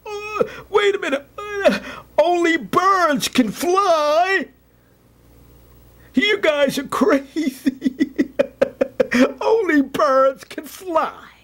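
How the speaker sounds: very high, drawn-out mocking clown. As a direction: squeal it slowly like a scoffing clown, laughing. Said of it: "A clown says".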